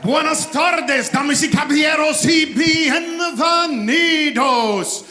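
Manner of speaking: booming fight-night ring announcer; lucha libre announcer. Real, not acted. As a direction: roll it out big like a cage fight intro. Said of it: "Sounds like he belongs in the U F C ring".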